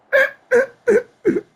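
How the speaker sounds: high burst of laughter; loud laugh. Real, not acted. Laughing at his own arrest story, big high laugh. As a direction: crack up at the memory.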